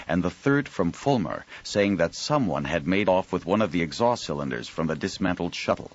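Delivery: ordinary delivery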